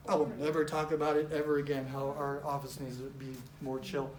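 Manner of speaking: low, quiet, deflated quote; subdued mock vow. Acting out someone who visited another office, subdued and low. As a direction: drop low and soft, imitating resignation.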